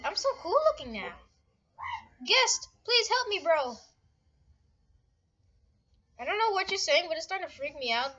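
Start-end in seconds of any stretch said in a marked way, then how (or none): 2.3-3.8 high-pitched plea for help; pleading call. Real, not acted. Player begging Guest for help, urgent pleading.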